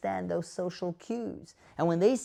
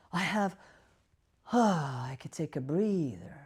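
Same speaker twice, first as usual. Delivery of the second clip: acted relief. Acting relief at having a schedule.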